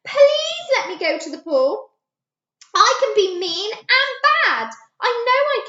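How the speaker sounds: high, loud pleading wail; high loud wailing plea. A narrator's reading. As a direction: wail it like a begging child. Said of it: "Gertie wailed".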